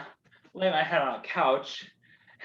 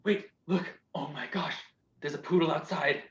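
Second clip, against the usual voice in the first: hushed awe; 3 semitones lower, 7 dB quieter than usual. Acted. Spotting a poodle, hushed.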